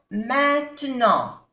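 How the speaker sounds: "maintenant" slowly, nasal ain and an. Shown as a demonstration. Teacher spelling the nasal vowels of "maintenant" slowly. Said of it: "A I N".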